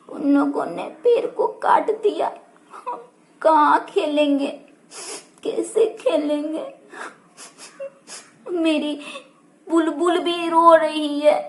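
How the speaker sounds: put-on soft, downcast child voice; a touch of tearful sadness; sad child. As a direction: tell a friend about the lost tree, close to tears. Sad child tells friend.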